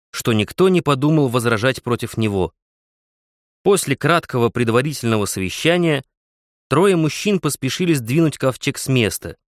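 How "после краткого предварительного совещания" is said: usual speaking voice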